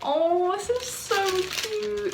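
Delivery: high, slow, drawn-out "aww" voice; touched cooing. Real, not acted. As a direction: coo it, full of longing for a friend. Touched by gift, misses friend.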